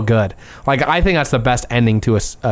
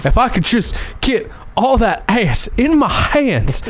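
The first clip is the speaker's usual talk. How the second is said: slow, put-on Texan drawl; slow mock Hank Hill voice; Hank Hill impression; 9 semitones higher, slower than usual. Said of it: "And he goes".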